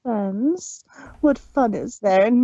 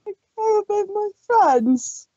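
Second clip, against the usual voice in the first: heartbroken; 10 semitones lower than usual. Acted. Pinky left alone on stage, heartbroken. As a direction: let her heart break. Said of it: "in a heartbroken voice".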